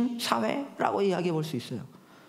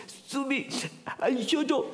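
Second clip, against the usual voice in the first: put-on soft, teary child voice pleading to daddy; a hint of a small daughter trying not to cry; pitiful little girl; 4 dB quieter, slower than usual. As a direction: plead like a tearful little girl holding back sobs. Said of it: "그 불쌍한 표정으로 보는".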